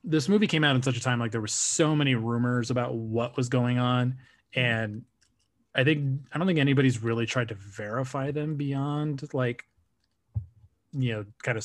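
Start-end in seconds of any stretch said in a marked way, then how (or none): none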